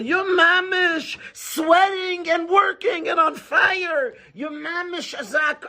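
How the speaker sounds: burn through every word; slow, high sermon shout; fiery preaching. Real, not acted. Preacher describes frantic zeal.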